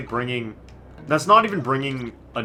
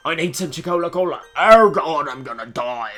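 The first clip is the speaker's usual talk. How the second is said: loud, puffed-up death-throes impression; mock dying sailor; 6 semitones higher, 9 dB louder than usual. Player mimics the character begging for a drink, hammed-up voice. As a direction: puff out the chest and overplay dying. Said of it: "I like how when he talks".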